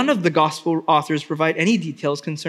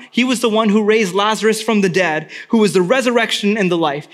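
ordinary delivery

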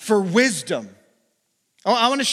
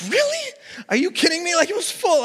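much higher, amazed disbelief; astonished; 11 semitones higher, faster than usual. Retold or imitated. Pastor retelling his shock at the front desk, much higher and amazed. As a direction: squeak out the disbelief. Retold shock at open class.

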